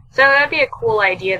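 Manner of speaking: ordinary delivery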